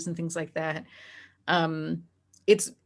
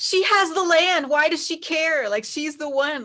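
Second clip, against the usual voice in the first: high and loud, incredulous; exasperated; 9 semitones higher, 4 dB louder than usual. Real, not acted. Complaining about the jealous wife, exasperated. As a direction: throw up your hands at the plot.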